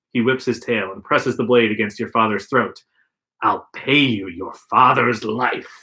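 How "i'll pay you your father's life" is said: menacing villain. Acted. Villain threatens.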